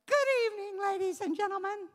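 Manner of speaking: doll voice. Retold or imitated. Child's ventriloquist doll voice.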